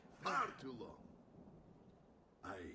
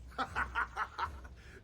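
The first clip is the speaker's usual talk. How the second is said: mocking laugh; 11 semitones higher, 8 dB louder than usual. Masked fighter mocks the boy.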